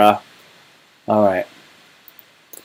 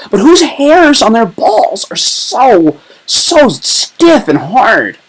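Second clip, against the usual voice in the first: mock incredulous; 10 semitones higher, much slower than usual. Joking about painted hairs.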